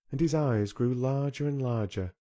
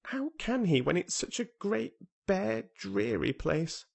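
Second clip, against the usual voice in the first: scornful; 11 semitones higher than usual. A narrator's reading. Colin scorns the moor.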